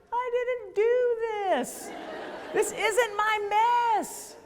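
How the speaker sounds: high, slow whine, drawn out; mock whiny protest. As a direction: plead it slowly like a wronged child.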